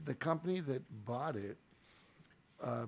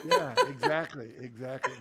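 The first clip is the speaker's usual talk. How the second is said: laughing agreement; 6 dB louder than usual. Real, not acted. Guest agreeing with the host's joke, laughing hard. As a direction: agree while cracking up.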